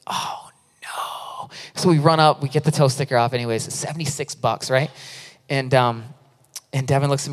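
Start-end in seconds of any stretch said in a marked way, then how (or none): 0.1-1.5 hushed, drawn-out whisper of dread; mock-dismayed whisper, sinking. Preacher recalls spotting the tow sticker on the rental van, dismayed whisper.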